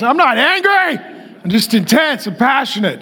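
high, defensive mock protest; angry guy in denial. Preacher mocking angry men in denial, defensive mock voice. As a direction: mimic a touchy guy insisting he's calm. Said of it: "that's what angry guys".

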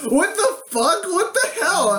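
high-pitched mock disbelief; retold appalled reaction. Player answering the vampire's awful remark, mock appalled. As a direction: act out being aghast, voice jumping up.

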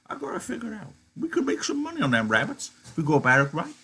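put-on high old-man voice for Candy, a bit embarrassed. Old Candy, embarrassed, to Crooks, high old-man voice.

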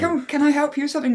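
high, quick assistant offer; put-on chirpy computer helper; eager helper. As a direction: pipe up helpfully, high and fast. Voicing Clippy the eager helper.

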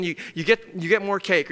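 ordinary delivery

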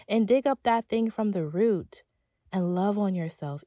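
usual speaking voice

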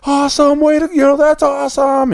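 high-pitched mock yell of praise; reenacted excited yelling, voice way up; imitated team cheering. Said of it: "They're just yelling her name".

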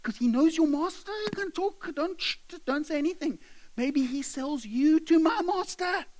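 high, strained furtive warning; acted squeaky alarm; nervous warning. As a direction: warn a friend he could be overheard. Slaves nervously shush each other.

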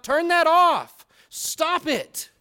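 put-on annoyed teen yelling at the light. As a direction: shout to kill the flashlight. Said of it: "you yell out".

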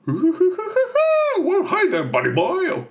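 very high, loud voice bursting with laughter; bouncy, laughing cartoon tiger; Tigger voice. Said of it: "Tigger. He's like".

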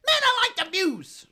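high, loud excited squeal; hammed-up high-pitched Kevin Hart; Kevin Hart impression. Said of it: "Kevin Hart is always like".

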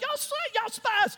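high-pitched accusing outburst, played for laughs; hammed-up high accusation; mock accusation. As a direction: hurl a high, mock-outraged charge. Joseph messing with brothers, accusing them.

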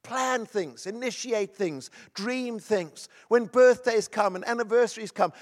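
loud, high, driving sermon delivery; rally the congregation to celebrate life; preaching at full force. Acted. Opening exhortation, raised voice.

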